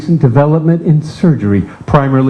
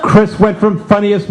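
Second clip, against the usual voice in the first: normal talking voice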